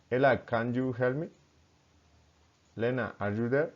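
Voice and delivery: questions to a named person, climbing at the end; rising intonation. Shown as a demonstration. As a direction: lift "me" and "there" upward. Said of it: "rising intonation".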